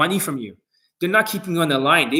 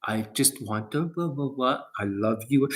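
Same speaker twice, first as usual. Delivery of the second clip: softer, slower, syrupy mock-sweet coach; mock cooing coach; 4 semitones lower, 9 dB quieter, slower than usual. Mocking coaches who coddle clients, softly cooing. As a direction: coo it like a fake-caring salesman. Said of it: "when they talk like that".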